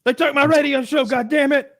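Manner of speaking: high-pitched aggrieved yell; mock outrage. Mocking a ranting radio host, high indignant yell. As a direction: wail like a wronged radio host.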